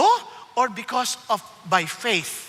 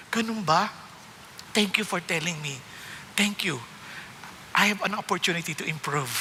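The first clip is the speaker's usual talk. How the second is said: humble thanks; 6 semitones lower, 6 dB quieter than usual. Pretend. Humble response to rebuke.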